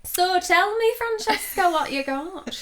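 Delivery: playing Sally in a bad Yorkshire accent; mock Yorkshire accent. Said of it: "This is gonna be a terrible accent".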